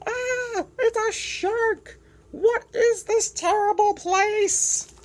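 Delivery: high, loud, frantic squeals; panicked penguin. Acted. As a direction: shriek at every new predator. Penguin meets predators, panics.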